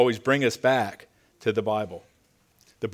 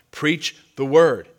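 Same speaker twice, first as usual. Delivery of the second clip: "Preach the word" slow and louder, weight on "word"; emphatic command; 2 semitones higher, 5 dB louder, slower than usual. Retold or imitated. Preacher urging church to teach well, slow emphatic "Preach the word". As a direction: stretch "Preach", then land hard on "word".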